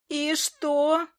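high-pitched creature squeal; shrill little familiar voice. A narrator's reading. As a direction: squeak the question in alarm. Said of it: "взвизгнула Фая".

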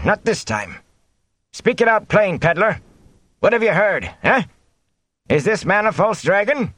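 high-pitched, defiant protest. A narrator's reading. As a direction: refuse to be silenced, demand answers. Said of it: "and raised his voice".

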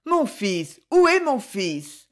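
a mother asking after her lost son, desperate and rising; hoarse, frightened plea. Acted. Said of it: "La voix sortait, toute rauque".

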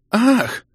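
sharp high loud cry; startled gasp. A narrator's reading. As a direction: cry out in sudden shock.

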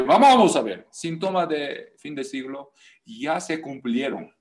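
usual speaking voice

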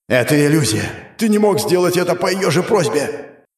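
high, forceful angry cry; furious denial. Acted. Demon strangling the hero, furious high outcry. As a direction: shout it down as a lie.

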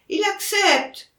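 very high, drawn-out exclamation; crying out. A narrator's reading. Son exclaims that his father accepts, crying out. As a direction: announce it high and slow in triumph. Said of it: "s'écria Alexandre".

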